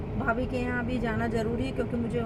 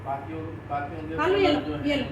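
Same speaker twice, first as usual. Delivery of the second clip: cooing to the dog; 8 semitones lower, much slower than usual. Real, not acted. Calling the family dog affectionately.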